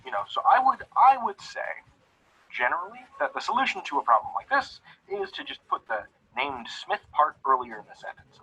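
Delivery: ordinary talk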